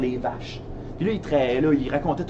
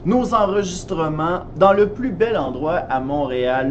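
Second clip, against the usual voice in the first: ordinary talk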